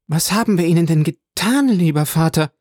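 höher und lauter, gekränkt flehend; flehende Enkelin. A narrator's reading. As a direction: bittend vorwurfsvoll fragen. Valentine fleht den Großvater an. (Valentine fragt den Großvater, höher und lauter flehend)

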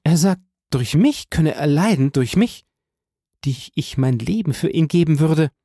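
high, impassioned voice; overwhelmed, high-pitched devotion; amazed young woman. A narrator's reading. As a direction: repeat it in astonishment, pitched high.

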